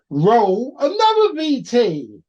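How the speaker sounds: loud, high, drawn-out cue to the crew; showman's call. Pretend. Presenter calling for another VT, loud drawn-out showman's call. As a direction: call it out slowly like a ringmaster. Presenter calls for the next clip.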